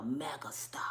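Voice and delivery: soft unvoiced whisper of the word. Comic teasing the crowd with a hushed whisper. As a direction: breathe it out like a secret.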